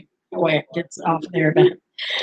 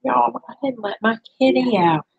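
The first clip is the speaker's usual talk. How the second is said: ordinary talk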